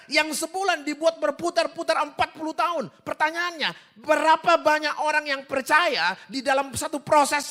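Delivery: high, booming sermon delivery; fervent preaching. Real, not acted. Preaches at full force.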